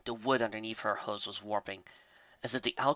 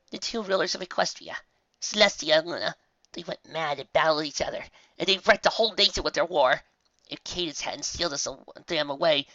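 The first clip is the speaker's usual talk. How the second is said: mildly voiced scared pony; 6 semitones higher, 5 dB louder than usual. A narrator's reading. Frightened pony explains legend.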